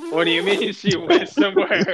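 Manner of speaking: can't believe it, half laughing; laughing disbelief. Retold or imitated. Asking Adam where the cousin went, laughing disbelief. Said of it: "And I'm like".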